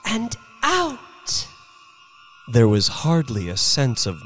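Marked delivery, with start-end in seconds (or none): 0.0-1.5 put-on high, lingering old woman's voice; Mrs Bellows's thought. A narrator's reading. Old woman drifting out into space, high musing voice. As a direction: draw the words out high, drifting away. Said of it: "thought Missus Bellows".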